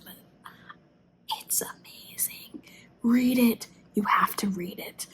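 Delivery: late-night whispered urging; urgent whisper. Real, not acted.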